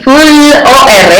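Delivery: F, L, O, R, each sound slow and apart; spelled out sound by sound. Shown as a demonstration. Teacher segments flor for the class, slow and separate. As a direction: break flor into its sounds very slowly. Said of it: "Primero tengo que segmentarla".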